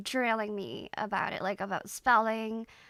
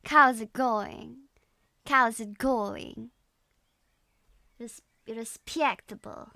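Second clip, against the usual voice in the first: slow, drawn-out Russian accent; Russian accent attempt; slower than usual. Shown as a demonstration. Streamer drilling the hard cow part, slow Russian accent. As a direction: stretch the vowels in a practised Russian accent. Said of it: "I can't get the cow part right".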